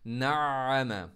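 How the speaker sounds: नअम drawn out slowly with ain from the throat; stressed ain. Shown as a demonstration. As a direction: let the ain in नअम flow from mid-throat. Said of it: "आइन पर शद्दा हो". (नअम धीरे, आइन गले के बीच से)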